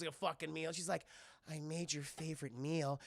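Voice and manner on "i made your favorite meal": low, soft, enticing voice; seductive woman. Retold or imitated. Hope in a nightie to Pan Man, mock seductive.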